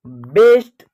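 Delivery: बेस्ट starting on a clear 'b'; B sound in बेस्ट. Shown as a demonstration. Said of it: "बी का ब उच्चारण हो रहा है". (बेस्ट में साफ़ ब)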